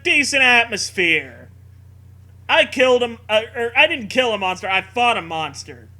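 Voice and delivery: normal talking voice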